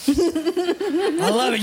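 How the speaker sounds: slow, high, laughing through the words. Real, not acted. Enjoying the skit with the teens, laughing. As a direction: laugh it out with delight.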